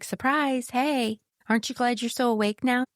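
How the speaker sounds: sarcastic surprise. Retold or imitated. Grief mocking the newly awakened listener, high and sarcastic. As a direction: tease like grief jumping out at you.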